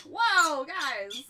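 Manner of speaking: loud, high startled whoa. Retold or imitated. Streamer retelling her fright to viewers, surprised whoa. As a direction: jump back in surprise. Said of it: "It actually scared me too".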